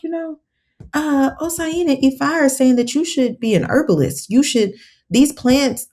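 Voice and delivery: lightly voicing the godfather as he delivers the message, slowing down; godfather's solemn voice. Godfather voiced in retold divination.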